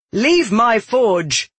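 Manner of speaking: slow, high, forceful order; commanding. A narrator's reading. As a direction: order him out, drawing out each word. Ordering the attacker out.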